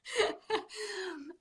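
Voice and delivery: quiet, high, choked little laugh; soft teary laugh. Real, not acted. Host recovering from tears, soft teary laugh. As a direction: let a tearful laugh fade out. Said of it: "Making me tear up over here".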